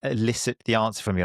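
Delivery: usual speaking voice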